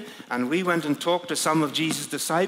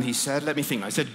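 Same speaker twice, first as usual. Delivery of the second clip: plain reading voice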